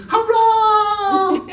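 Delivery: put-on very high, loud kid's hurrah; child cheer. Young Cratchits cheer.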